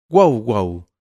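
loud barked woof woof; big dog barking loudly. A narrator's reading. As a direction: bark like a huge dog. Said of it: "se puso a ladrar".

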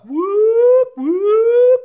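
very high, loud siren whoop; imitated alarm whoop. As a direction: mimic a shrill alarm siren. Imitating the house alarm going off.

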